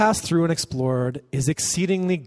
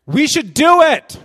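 rousing shout; 13 semitones higher, 10 dB louder, slower than usual. Acted. Caleb voiced, rising to a cry.

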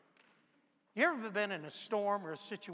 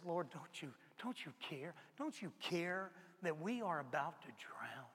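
quiet plea; 3 semitones lower, 7 dB quieter than usual. Retold or imitated. Peter retells his plea quietly.

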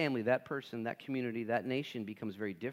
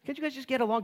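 ordinary talk, nothing marked

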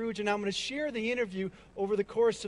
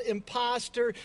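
ordinary talk

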